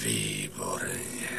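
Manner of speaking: low, soft, drawn-out approval; sinister satisfaction. Acted. Impostor receives the mirror from his bride, sinisterly satisfied. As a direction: savour the win, dropping deep and quiet.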